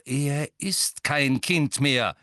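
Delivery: langsam und unwirsch. A narrator's reading. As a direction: barsch abschneiden. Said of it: "sagte Sirius unwirsch". (Sirius fällt Molly unwirsch ins Wort)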